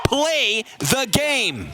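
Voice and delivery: slow, high shouted catchphrase; drawn-out shout. Acted. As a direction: stretch out every word. Caster's climactic call.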